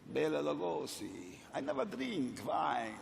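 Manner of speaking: put-on soft, lightly done Hungarian Dracula accent; a quiet hint of Lugosi's vampire; Bela Lugosi accent. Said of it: "I love the sound of people's accents".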